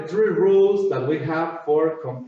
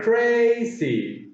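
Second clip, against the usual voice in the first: CRAZY stretched into two beats, high first syllable; split into syllables; 5 semitones higher than usual. Shown as a demonstration. Teacher counting two syllables, CRAZY pulled apart. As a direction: hold the first syllable of CRAZY, then drop. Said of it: "Two syllables".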